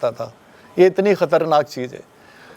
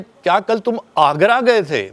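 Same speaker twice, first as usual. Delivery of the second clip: slow question with Agra drawn out and stressed. Shown as a demonstration. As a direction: lean on Agra, let गए थे fall away. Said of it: "मतलब कहीं फिरोजाबाद भी जा सकते थे".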